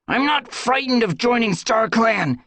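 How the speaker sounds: high, loud defiant retort; spat back. Acted. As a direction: spit it back at her. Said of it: "Crowpaw spat back".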